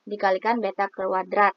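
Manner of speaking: ordinary talk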